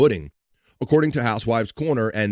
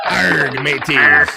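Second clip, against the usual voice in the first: hammed-up pirate growl, louder at the end; 3 semitones higher, 7 dB louder, much slower than usual. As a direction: ham up a pirate hail. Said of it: "Let's talk like a pirate".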